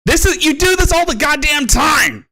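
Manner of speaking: high-pitched yell; exasperated scolding. Acted. Scolding the other streamer for doing it constantly, high exasperated shout.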